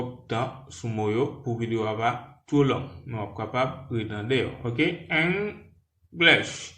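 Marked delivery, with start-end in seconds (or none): 5.1-6.7 English said /enɡleʃ/, first syllable EN stressed, high and clear; two clear syllables EN-glish; model pronunciation. Shown as a demonstration. Teacher modelling English for French learners. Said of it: "Essayez donc".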